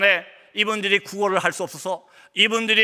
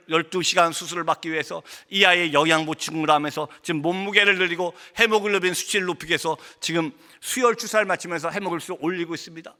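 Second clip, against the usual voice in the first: ordinary talk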